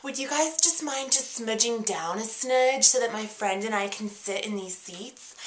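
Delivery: soft, overly polite request; very sweet, polite. Retold or imitated. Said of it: "really sweetly, like, very polite".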